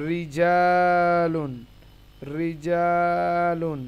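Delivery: রিজালুন very slow, light "ri" and long-held "jaa"; thin Ra. Shown as a demonstration. Teacher gives the zer example, রিজালুন slow with thin Ra. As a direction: draw out রিজালুন from a thin, clear R. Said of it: "র পাতলা হবে".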